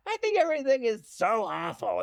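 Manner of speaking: high, put-on voice breaking into a laugh; hammed-up impression of a theatrical drama teacher; imitating the acting teacher. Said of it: "because my teacher would talk like that".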